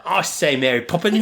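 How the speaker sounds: joking Mary Poppins-style Cockney; mock Cockney accent. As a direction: ham up a Cockney accent through laughter. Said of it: "sounded a little more Cockney".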